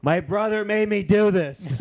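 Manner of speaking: hammed-up whine about the brother; mock complaint. Retold shout from background.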